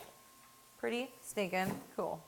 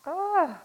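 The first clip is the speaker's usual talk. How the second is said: loud, high exclaimed "ah"; pained cry; 10 semitones higher, 8 dB louder than usual. Real, not acted. Cook taking a moment from onions, pained cry. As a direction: cry out to release the sting.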